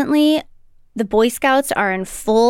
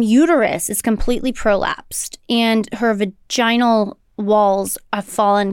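usual speaking voice

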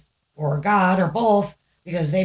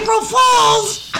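high, loud, drawn-out yell; shouted punchline; 8 dB louder, much slower than usual. Pretend. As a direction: burst in with a slow gotcha yell. Cuts in repeating the punchline.

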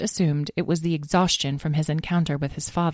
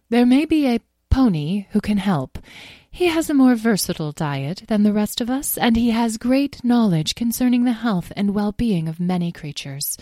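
higher, formal pony voice; gracious pony, thoughtful; 5 semitones higher than usual. Acted. Starfire suggesting a pony who can help, gracious. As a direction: recommend a helper with gentle dignity.

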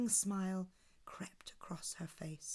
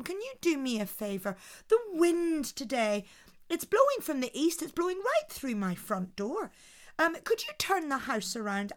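higher, mocking and brisk; scornful Una; 5 semitones higher, 4 dB louder than usual. Clever wife laughs at the giant, mocking higher voice. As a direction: belittle the giant playfully.

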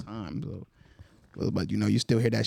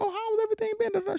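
high-pitched small-talk voice; acting out your own high-pitched greeting; retold casual question. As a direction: put on a high, polite catching-up voice. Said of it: "I was just kinda like".

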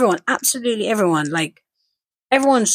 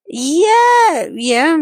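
teasing high yeah; 10 semitones higher, 5 dB louder than usual. Retold or imitated. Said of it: "I kept saying to him".

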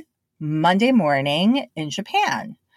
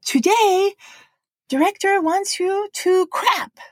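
put-on high Japanese accent that turns clap into crap; Japanese-accented English. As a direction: announce it politely in a Japanese accent. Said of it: "And then he said".

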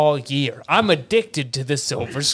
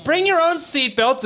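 higher repeat for clarity; louder repeat; 10 semitones higher than usual. Real, not acted. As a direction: say it again higher so they hear.

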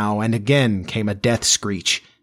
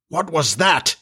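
higher-pitched startled question; alarmed cry; 6 semitones higher than usual. A narrator's reading. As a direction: demand it in sudden alarm. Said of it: "cried Hook".

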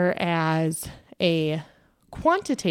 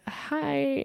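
put-on gentle young tech; 9 semitones higher, 5 dB quieter than usual. Young lab tech greets her.

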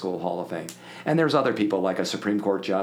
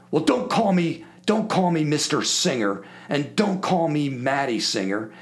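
louder, higher, hammed-up insistent voice; mock gruff rules; 5 semitones higher, 3 dB louder than usual. Singer scolding the caller about his name, hammed-up insistence. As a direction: lay down the rules with comic bluster.

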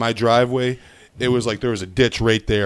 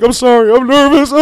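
loud, high-pitched blubbering imitation; hammed-up tearful apology; mock crying; 8 dB louder than usual. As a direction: wail it like a comic crybaby. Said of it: "starts crying".

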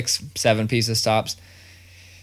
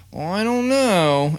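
put-on higher, slow, unsure kid voice; imitating his kid; 9 semitones higher, slower than usual. Dad mimicking his picky son, high slow doubtful whine. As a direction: drag it out like a doubtful child. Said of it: "and he'd be like".

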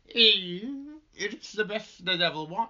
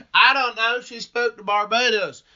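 put-on southern accent; 6 semitones higher, 11 dB louder than usual. Mocking a southern drawl.

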